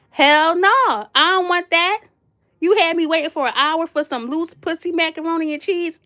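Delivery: high, loud, outraged no; retold loud refusal. Guest refusing the host's runny macaroni, loud and outraged. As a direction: blast the offer away, appalled. Teller retells her refusal.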